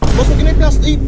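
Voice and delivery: sudden loud call to stop the vendor; a quick loud holler, not angry. Acted. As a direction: shout it across the counter to stop him. Customer calls out to stop vendor.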